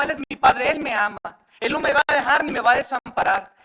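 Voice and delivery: loud, high declamation of scripture; fervent, shouted sermon delivery; full-force preaching. Real, not acted. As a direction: proclaim each phrase loudly to the congregation.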